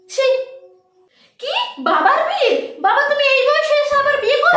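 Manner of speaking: high, incredulous questions; shocked disbelief. Acted. Daughter hearing father will remarry, high and incredulous. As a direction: gasp out the disbelief.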